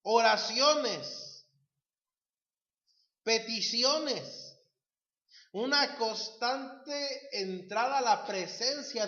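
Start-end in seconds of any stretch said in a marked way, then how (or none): 0.0-4.6 Oraciones and Peticiones drawn out slowly and louder, each falling; slow emphatic words. Acted. Preacher hammering each word to the congregation, slow and loud. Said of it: "preacher stresses list".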